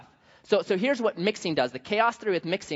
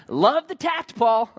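ordinary talk, nothing marked

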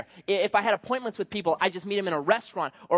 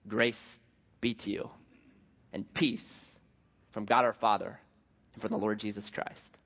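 gracious greeting; 8 semitones lower, 6 dB quieter than usual. Retold or imitated. Paul's gracious greeting, softly read.

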